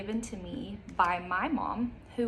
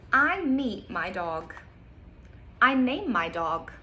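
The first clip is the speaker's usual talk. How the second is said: bright storybook reading, slow on the details; reading to children; slower than usual. Acted. Teacher reading a picture book to kids, lively storytime voice. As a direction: read it aloud like storytime.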